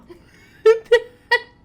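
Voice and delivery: loud, high nervous laugh; startled laugh. Real, not acted. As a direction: laugh off the fright. After a jump scare.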